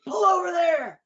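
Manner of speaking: high, loud commanding cop. Retold or imitated. Cop ordering her off the road, commanding. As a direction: order her to pull over. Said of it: "And he's like".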